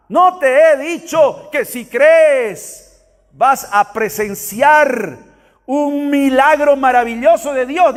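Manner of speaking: slow, high, forceful proclamation; proclamación enfática. Acted. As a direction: proclaim it, stretching each phrase. Proclama enfática de las palabras de Jesús. (proclamando lento y con fuerza)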